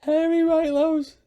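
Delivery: amused disbelief. Real, not acted. Repeats joke in disbelief.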